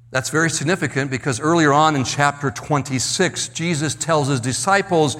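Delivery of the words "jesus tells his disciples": ordinary delivery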